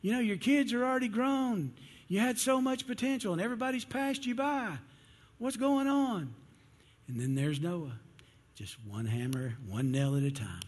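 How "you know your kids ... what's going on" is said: much higher, taunting townsman; mocking holler. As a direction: jeer at the old man across the yard. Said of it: "hollering at him".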